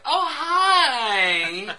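high, loud, gushing fake greeting; exaggerated phony delight; fake sweetness. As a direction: greet like a star with a pasted-on smile. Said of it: "the smile just melts".

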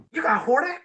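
high, surprised question. Retold or imitated. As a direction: act shocked he has it. Kid surprised at friend's toy.

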